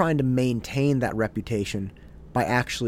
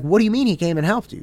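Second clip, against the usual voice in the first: mock-upset fast demand, raised; blurting out the question, upset and pitched up; 7 semitones higher, 5 dB louder, faster than usual. Friend got help elsewhere, mock-upset fast protest. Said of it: "I got really upset".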